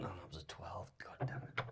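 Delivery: whispered under the breath; whispered curse. Real, not acted. Player muttering after a bad roll, whispered. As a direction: swear quietly to yourself.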